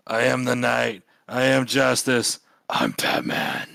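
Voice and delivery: slow, dramatic vigilante declaration; Batman. Acted. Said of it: "And then Dark, Dark Knight says".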